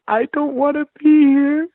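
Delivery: hammed-up crying plea to go home; imitating crying. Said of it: "And I'm crying".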